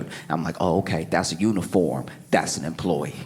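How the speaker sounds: soft, low secretive telling; quiet stall-hiding tale; hushed. Retold or imitated. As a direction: keep it down, peeking under the stall.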